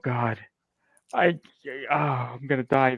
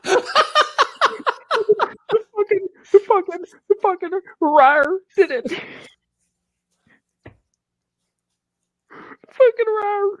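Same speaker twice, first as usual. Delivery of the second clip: laughing; 3 dB louder than usual. Real, not acted. Co-host cracking up at rawr.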